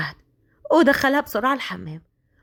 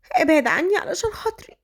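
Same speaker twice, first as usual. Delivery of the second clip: tearful plea; 8 semitones higher than usual. A narrator's reading. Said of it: "قالت كده بدموع".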